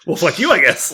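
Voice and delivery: high, loud retort breaking into laughter; laughing, exaggerated indignation; mock-offended retort. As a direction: act miffed while cracking up. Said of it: "And I'm like".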